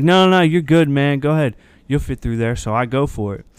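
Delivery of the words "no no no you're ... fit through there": louder, higher, insistent "you're good"; insistent flagger. Retold or imitated. Flagger waving the nervous driver through, loud and sure. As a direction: wave the driver on with loud confidence.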